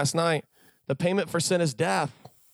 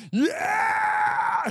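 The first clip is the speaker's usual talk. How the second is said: excited shout. Retold or imitated. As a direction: yell it like free donuts just appeared. Excited about morning manna.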